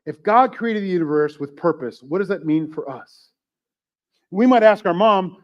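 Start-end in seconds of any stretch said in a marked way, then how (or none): none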